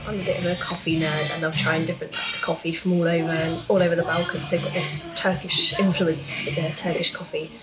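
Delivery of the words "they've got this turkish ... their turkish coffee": usual speaking voice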